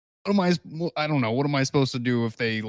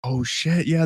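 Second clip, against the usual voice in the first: normal talking voice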